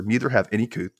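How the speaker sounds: ordinary talk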